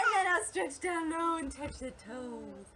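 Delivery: descending singsong. Shown as a demonstration. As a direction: slide the voice down towards the toes. Voice descends with stretching down again.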